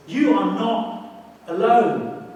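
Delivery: loud and very slow, pressing each word; emphatic reassurance. Real, not acted. The preacher insists to his listeners they are not alone. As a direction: land it hard so they believe it. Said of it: "emphatic reassurance to congregation".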